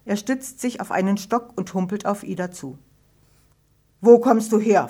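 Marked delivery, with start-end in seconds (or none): 4.0-4.9 a hard, much louder demand; stern question. A narrator's reading. Father to his late daughter, a stern loud demand. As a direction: confront her like a strict father. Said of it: "fragt er streng".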